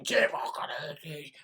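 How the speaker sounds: whispered instead of screamed; hushed imagined cheer, all breath; mock scream. Fan whispering a pretend cheer at his mum. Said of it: "and just scream".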